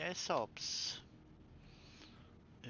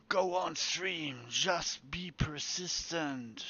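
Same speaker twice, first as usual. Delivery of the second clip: loud and slow, urging it on; 3 semitones higher, 7 dB louder, slower than usual. Real, not acted. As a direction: push it on, drawn out and loud. Urging the game to keep going.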